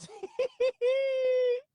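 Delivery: long high squealing laugh; helpless laughing. Real, not acted. Streamer unable to finish a sentence, helpless squealing laugh. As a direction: crack up into a drawn-out high squeal. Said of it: "why I'm laughing so hard".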